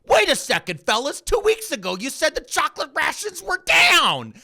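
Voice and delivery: high, loud incredulous shout; mock indignant realisation. Orwell hero catching the rulers' lie, mock shout. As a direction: cry it out like catching the liars. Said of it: "the main character's like".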